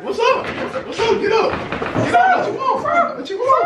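high squeaky hammed-up taunts; mock fight challenge. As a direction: squeal out a playful dare.

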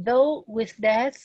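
though with death, very slow, "though" rising; slow textbook reading; read slowly. Shown as a demonstration. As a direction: draw the phrase out word by word. Reads English text aloud.

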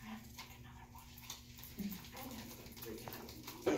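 ashamed, barely voiced apology; telling a patient the impression failed, sheepish whisper; embarrassed whisper. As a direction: whisper it as if you dread saying it. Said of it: "go to your patient and say".

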